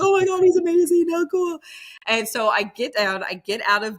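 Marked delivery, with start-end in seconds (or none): 0.0-1.6 much higher and louder, gushing; starstruck fan. Retold or imitated. Watching Rodney Scott on Netflix in the basement, gushing much higher and louder.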